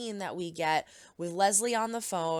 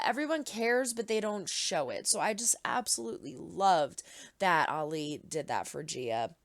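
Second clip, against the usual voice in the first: ordinary talk, nothing marked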